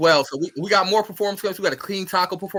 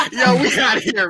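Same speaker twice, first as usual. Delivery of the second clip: laughing sign-off; 8 semitones higher, 3 dB louder than usual. Real, not acted. Host laughing while signing off.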